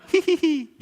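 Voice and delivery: high thin hihihi; small person's giggle. Shown as a demonstration. Teller contrasting a little person's laugh, high giggle. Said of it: "Kalau ketawa orang kecil".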